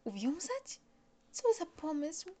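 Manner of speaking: put-on high, slow, shocked boyish question; be scandalised by the idea, rising high; shocked child. Little prince shocked by pilot's tie-up idea, high indignant child voice. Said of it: "zszokowała małego księcia".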